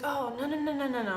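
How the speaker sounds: put-on a kid begging off; refusing student. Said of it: "he was like".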